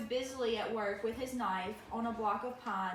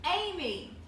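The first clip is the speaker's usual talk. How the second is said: calling out; 7 semitones higher, 4 dB louder than usual. Acted. Mother calling for child.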